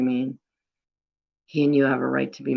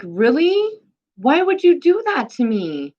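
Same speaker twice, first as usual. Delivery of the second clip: indignant disbelief; 10 semitones higher than usual. Real, not acted. Venting about being wronged.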